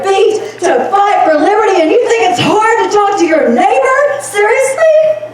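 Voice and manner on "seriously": slow, much higher "Seriously?"; incredulous. Real, not acted. Speaker scolding audience afraid to talk to neighbors, incredulous. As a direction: drag it out in disbelief.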